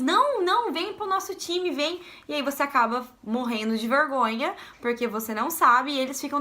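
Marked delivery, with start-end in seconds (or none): 0.0-2.0 eager insisting. Retold or imitated. American classmates pulling the Brazilian into their team, eagerly. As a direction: beg her to join like keen teammates.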